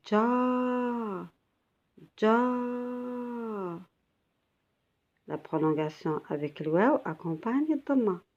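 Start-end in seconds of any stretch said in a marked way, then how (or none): 0.1-3.8 ja drawn out long and higher; long vowel. Shown as a demonstration. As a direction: stretch the a of ja, pitched up. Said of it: "Alors ici, j'ai". (ja, voyelle longue tenue et aiguë)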